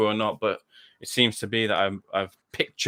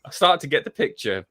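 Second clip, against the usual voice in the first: ordinary talk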